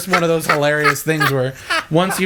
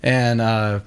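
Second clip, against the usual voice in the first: normal talking voice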